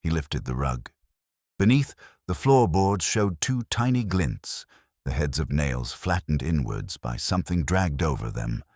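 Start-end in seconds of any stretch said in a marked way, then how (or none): none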